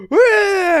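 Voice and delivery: loud high yell; mock yell. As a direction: yell a sudden "Ah". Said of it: "you don't go".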